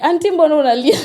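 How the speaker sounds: laughing retold kid's question. Street kid asking auntie why she cries, laughing. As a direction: voice the street kid while cracking up. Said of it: "one of them was like".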